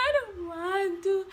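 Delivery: slow, high, whiny I don't want to; dramatic whine. Retold or imitated. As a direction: drag it out like a sulky child. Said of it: "I was so dramatic".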